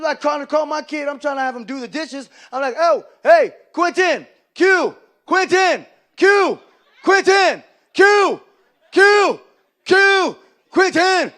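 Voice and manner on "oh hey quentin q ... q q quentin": loud, high, repeated parental holler; calling his kid. Acted. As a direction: yell for a child who keeps ignoring you. Calling his kid who won't come.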